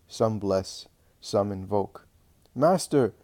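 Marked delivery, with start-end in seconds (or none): 2.5-3.1 calling out to the Master, a touch louder; a mother's raised call, understated; mild shout. A narrator's reading. Mother calling out to Jesus for help, a mild shout. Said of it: "shouts a mother".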